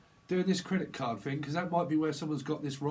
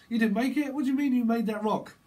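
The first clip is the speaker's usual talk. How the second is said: loud, high mock outrage; acted disbelieving outburst; incredulous accusing; 6 semitones higher, 5 dB louder than usual. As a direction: challenge them with raised voice. Acted quarrel over fake rock.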